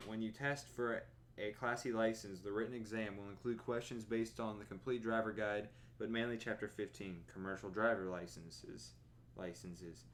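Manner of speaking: usual speaking voice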